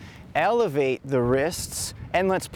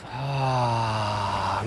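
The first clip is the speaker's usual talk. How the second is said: pulsed ha sounds; 4 semitones lower than usual. Shown as a demonstration. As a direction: breathe out a gentle ha. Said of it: "make a little H A sound, little ha".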